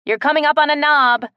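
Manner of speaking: loud warning call. A narrator's reading. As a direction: warn her from below. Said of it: "Kellen warned".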